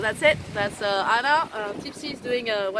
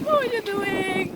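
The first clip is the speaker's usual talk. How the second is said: much higher, slow plaintive put-on voice; voicing Tipsy; 7 semitones higher than usual. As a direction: play Tipsy calling from the boat. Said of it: "She was like".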